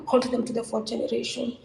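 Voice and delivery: ordinary delivery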